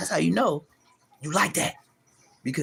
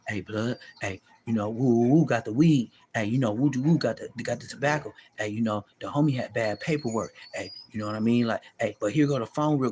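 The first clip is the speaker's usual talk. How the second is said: lower-pitched inmate hustling a cellmate; lightly voiced prison hustler, lower; imitating an inmate; 6 semitones lower than usual. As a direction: play a prison hustler offering weed on the low.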